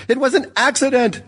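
high-pitched desperate cry; screaming. A narrator's reading. Lisa to the ghost over the crash, screaming. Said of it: "She was screaming. Everyone in the building could hear her".

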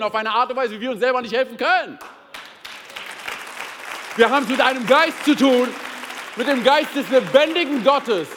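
loud, driving preacher's build; preaching at full force. Acted. Preacher proclaiming the one true helper, fervent. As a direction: proclaim it to the whole hall. Preaching climax about the Spirit.

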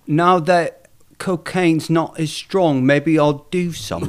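slow, lightly voiced English accent; a mild attempt at an English accent. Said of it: "I can't do an English accent".

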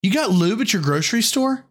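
high, incredulous question; shocked disbelief; surprised. Real, not acted. Reacting to lube answer.

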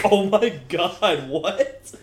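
slow, laughing oh my God; stunned and amused; laughing disbelief. Retold or imitated. Friend retelling her stunned reaction, slow laughing disbelief.

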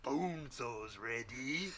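Bonesaw catchphrase. Acted. Spider-Man Bonesaw quote.

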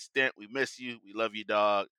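usual speaking voice

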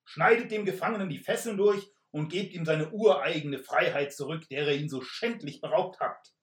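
knight shouting. Acted. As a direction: thunder the command like a stage knight. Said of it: "rief der Ritter lauter".

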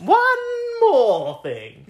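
high, loud, stretched-out sing-song announcement; mock announcement. Teasing her co-host as she announces her final fact, high and stretched out. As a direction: draw it out like a grand reveal. Teasing echo of one more.

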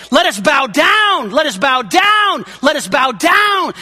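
slow, loud, hammered repetition; emphatic repeated call. Acted. Preacher urging the church to bow down. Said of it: "So he's saying".

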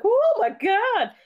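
high, drawn-out gasping exclamation; mock shock. Friend retells the guests' reaction, mock shock. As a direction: stretch it out in pretend horror.

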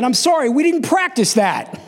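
put-on high-pitched kid's excuse; acted-out panicky blurting to dad; flustered apology. Child blurts out the secret.

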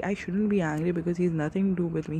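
normal talking voice

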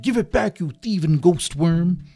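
angry villain shout. Acted. Hypnotizer to Doggy stealing the poppet, furious. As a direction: demand it back, shouting.

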